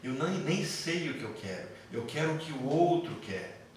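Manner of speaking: normal talking voice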